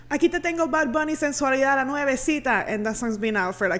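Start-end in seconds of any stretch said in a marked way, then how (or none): 0.1-2.6 hyped-up DJ hype in Spanish, higher and louder; radio DJ hype, high and loud; mock radio announcer. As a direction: sell it like a radio host. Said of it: "and then we say".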